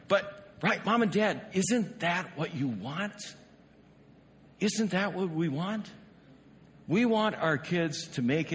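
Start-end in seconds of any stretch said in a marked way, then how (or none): none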